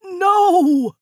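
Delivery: loud, high, drawn-out scream; long storybook villain's scream of defeat. A narrator's reading. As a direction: scream it in horrified protest.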